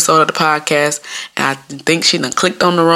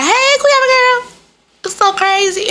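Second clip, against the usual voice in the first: very high, drawn-out squealed greeting; gushing schoolmate; slower than usual. Retold or imitated. Schoolmate spotting her out with mama, mock gushing squeal. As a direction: imitate an over-friendly girl way up high.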